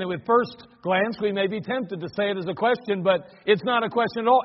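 normal talking voice

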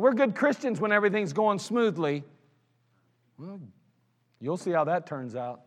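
ordinary delivery

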